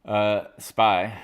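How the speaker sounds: usual speaking voice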